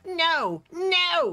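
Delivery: high, loud desperate cry of "No!"; the villain screams as his citadel falls; panicked shout. Acted. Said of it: "he cries".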